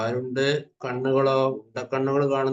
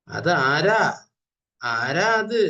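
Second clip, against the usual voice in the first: loud reading; 3 semitones higher, 5 dB louder, much slower than usual. Shown as a demonstration. Reading dragonfly lines aloud.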